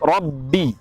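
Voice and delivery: ordinary talk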